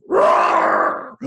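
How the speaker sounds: loud, harsh roar in the ear; lion roar. A narrator's reading. Storyteller as lions roaring in people's ears, loud roar. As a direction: let out a sudden big roar. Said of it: "The lions roared in people's ears".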